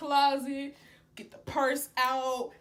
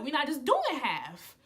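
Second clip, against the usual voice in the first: usual speaking voice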